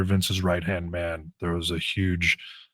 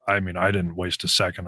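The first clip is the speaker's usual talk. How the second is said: ordinary talk, nothing marked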